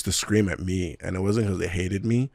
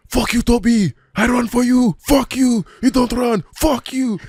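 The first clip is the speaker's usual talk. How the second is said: much higher and louder, furious yelling; retold furious scream; 9 dB louder than usual. Teammate yelling at him in training, furious scream. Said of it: "what are you screaming at me for".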